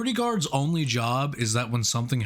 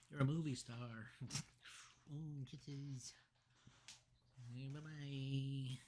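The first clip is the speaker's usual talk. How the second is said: cooing to a pet; 2 semitones higher, 21 dB quieter, slower than usual. Real, not acted. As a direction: coo tenderly to a cat, barely audible. Cooing to the cat.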